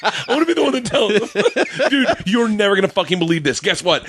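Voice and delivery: high, giddy mock delivery of bad news; gleeful messenger. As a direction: play the kid dying to spill it.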